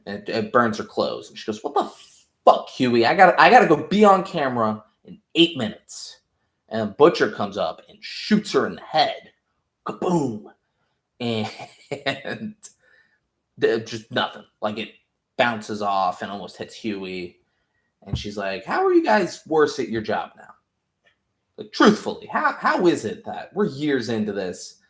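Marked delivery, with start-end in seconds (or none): 1.6-6.2 higher, louder exasperated yelling; angry outburst. Retold or imitated. Nadia yells at Huey over burnt clothes, exasperated shout. 18.6-24.8 higher, incredulous disbelief, peaking on "truthfully"; exasperated scolding. Retold or imitated. Nadia scolds the boys for failing, incredulous exasperation.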